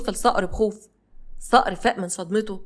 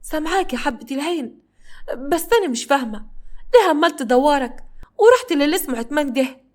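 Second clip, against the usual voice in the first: higher, holding back fear; hiding fear; 6 semitones higher than usual. A narrator's reading. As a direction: fake calm while scared underneath. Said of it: "بتحاول تكتم صوتها وبتتكلم بصوت طبيعي". (خوف مكتوم بصوت أعلى)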